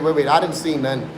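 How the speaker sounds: ordinary talk, nothing marked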